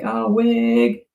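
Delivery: high, sassy drag queen put-on; a playful impression with a pitch jump; drag queen slang voice. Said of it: "Everyone's saying".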